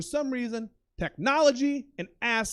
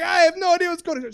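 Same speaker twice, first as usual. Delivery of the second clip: high, loud, flustered reply; panicked protest; 8 semitones higher, 8 dB louder than usual. Retold or imitated. Confused passenger to screaming driver, panicked protest.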